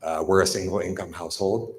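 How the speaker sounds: ordinary talk, nothing marked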